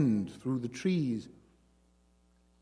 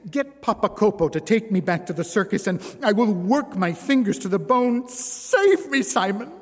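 pleading, climbing much higher and louder; desperate plea; 8 semitones higher, 7 dB louder than usual. A narrator's reading. Jeanne desperately begging Simon to save her, rising to a cry.

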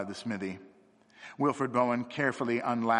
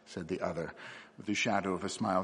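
usual speaking voice

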